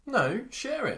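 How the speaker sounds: high insistent call; shouting. Real, not acted. Insisting listeners share stories.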